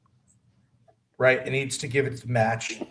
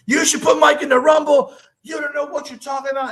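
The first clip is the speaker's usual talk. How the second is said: high, loud mock fan yell; 13 semitones higher, 8 dB louder than usual. Fans at intermission yelling at the wrestler, mock shout. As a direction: imitate heckling fans shouting at him. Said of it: "his fans are yelling at me".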